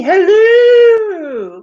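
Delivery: high, loud, long-drawn hooting greeting; Mrs. Doubtfire. Acted. Host greeting Kelly, high drawn-out Mrs. Doubtfire voice. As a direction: trill it out very high and stretched. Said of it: "Missus Doubtfire".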